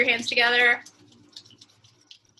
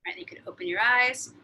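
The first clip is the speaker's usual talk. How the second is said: soft whispered wake-up; 3 dB quieter than usual. Acted. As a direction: gently whisper them back. Ends the meditation softly.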